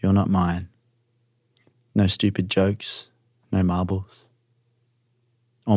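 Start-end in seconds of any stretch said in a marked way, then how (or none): none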